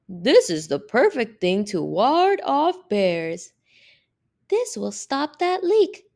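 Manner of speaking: high, emphatic character voice; enthusiastic onlookers. Acted. Passers-by praise the girl's things, exclaiming. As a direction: swoop up high on the key words. Admirers' quoted comments, acted in voices.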